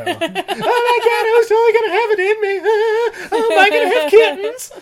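very high, loud wail through laughter; hammed-up falsetto panic, half laughing; mock hysterics. Podcaster mocks Tanya's worry over the cat man, mock hysterics.

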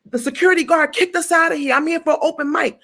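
high-pitched, aggrieved retelling; indignant complaint. Retold or imitated. Complaining to a stranger about the guard, indignant. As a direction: plead your case, a bit put out.

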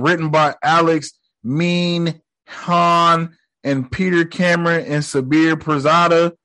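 normal talking voice

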